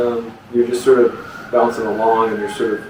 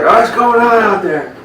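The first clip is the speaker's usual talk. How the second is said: high-pitched startled-homeowner call; 11 semitones higher than usual. Acted. Homeowner to whoever is fumbling with his horse, calling out high. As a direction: call out into the yard in a raised pitch.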